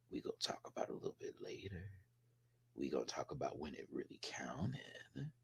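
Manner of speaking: very soft, low, secretive murmur; hushed aside. Real, not acted. Reviewer hinting to viewers at what comes later, hushed aside. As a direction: drop to a near whisper.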